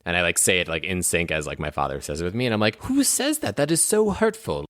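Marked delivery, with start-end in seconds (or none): 2.8-4.7 put-on hurt child. Young Belmont protests at his dad.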